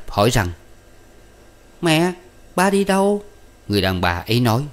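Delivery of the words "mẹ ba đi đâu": put-on high-pitched little child asking; small child's voice. A narrator's reading. Toddler asks mother where father went, small child's voice. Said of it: "Đứa nhỏ giỡn cười reo một chập".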